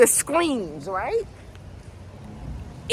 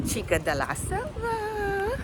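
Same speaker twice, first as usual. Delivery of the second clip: very high, soft squeal; playful Spanish; 10 semitones higher, 6 dB quieter than usual. Real, not acted. Calling herself a jungle girl in a high playful squeal.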